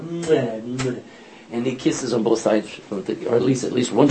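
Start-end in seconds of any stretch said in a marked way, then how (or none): 0.0-1.0 French kiss sounds. Retold or imitated. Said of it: "just like the French go".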